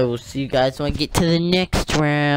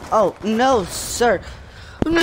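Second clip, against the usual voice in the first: high alarmed cry; 8 semitones higher, slower than usual. Real, not acted. Alarmed in a fight.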